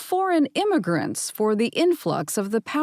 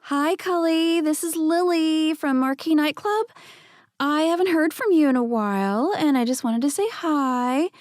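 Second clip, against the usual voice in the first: put-on higher, breathy-sweet voicemail from a club girl; 7 semitones higher than usual.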